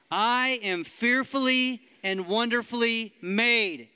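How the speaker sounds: slow, loud and high affirmation; loud declaration. Shown as a demonstration. Preacher leads the room in declaring, slow and loud. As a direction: shout it like you believe it. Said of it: "say 'em like you believe them".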